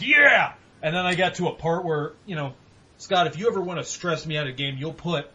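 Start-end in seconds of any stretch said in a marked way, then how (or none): 0.0-0.5 high, loud triumphant "Yeah!"; victory yell. Retold or imitated. Gamer cheering after finally beating the boss, loud triumphant yell. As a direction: shout it like you just beat the boss. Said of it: "and I was like".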